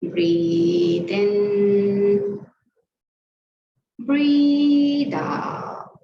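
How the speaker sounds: long, stretched breath cues; drawn-out breathing cues. Shown as a demonstration. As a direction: guide the class breath by breath. Yoga teacher cueing breaths.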